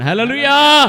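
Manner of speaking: loud, high hallelujah call; preacher's rallying cry; praise shout. Real, not acted. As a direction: ring it out to rouse the congregation. Preacher calls congregation to praise.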